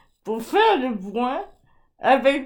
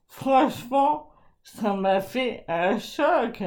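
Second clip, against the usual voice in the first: ordinary talk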